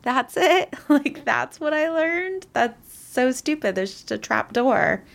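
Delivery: high, slow letdown; disappointed deflated scoff; mock disappointment. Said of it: "you're like".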